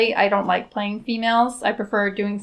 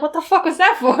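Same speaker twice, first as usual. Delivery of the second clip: baffled onlooker; 8 semitones higher, faster than usual. Retold or imitated. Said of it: "everyone's looking at me like".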